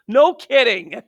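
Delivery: loud, high, mocking emphasis; sarcastic no kidding. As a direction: throw it out sarcastically. Sarcastic reply to teacher shortage.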